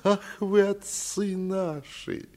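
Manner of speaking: high, drawn-out tearful flattery; sing-song fawning. A narrator's reading. Tipsy steward greeting his master, high fawning sing-song. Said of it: "заговорил он нараспев и с таким умилением".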